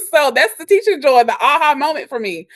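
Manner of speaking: high, loud, welling up for real; getting emotional. Real, not acted. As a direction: push through rising emotion, voice lifted. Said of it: "I'm getting emotional".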